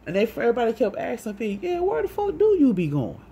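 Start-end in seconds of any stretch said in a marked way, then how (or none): 1.6-3.2 high, loud imitation of nosy questioning; demanding question. Everybody questioning her whereabouts, demanding. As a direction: demand an answer, pitched way up.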